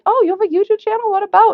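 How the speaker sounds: high, quick mock-interested question; imitating curious acquaintances. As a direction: play someone intrigued by the news. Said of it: "they're like".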